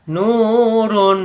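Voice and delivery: নূরুন with নূ drawn out long and slow; stretched vowel. Shown as a demonstration. Teacher stretching নূরুন slowly for students. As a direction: hold the নূ in নূরুন a full beat. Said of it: "এই নুকে এক আলিফ টানতে হবে".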